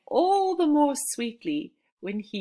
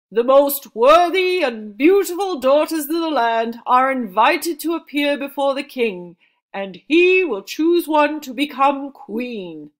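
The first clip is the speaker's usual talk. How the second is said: loud proclamation; 6 semitones higher, 11 dB louder than usual. A narrator's reading. Messenger announcing to the family, booming proclamation. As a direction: proclaim it to the whole village. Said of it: "the messenger proclaimed".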